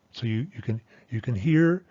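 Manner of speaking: ordinary delivery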